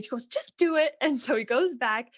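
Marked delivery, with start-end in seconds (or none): 0.3-0.9 high, louder bossy order; the demanding wife ordering her husband, high and bossy. Retold or imitated. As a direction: snap it out as a command. Wife commands in tale.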